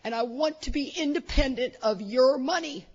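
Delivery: mock-tearful plea, climbing high; imitated crying son. Crying son to mom about independence, imitated tearful plea. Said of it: "and he's crying".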